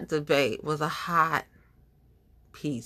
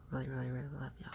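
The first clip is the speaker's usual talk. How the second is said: fast, much softer gushing; rushed soft affection; 3 semitones lower, 14 dB quieter, much faster than usual. Real, not acted. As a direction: murmur it quickly and fondly. Affectionate aside to viewers.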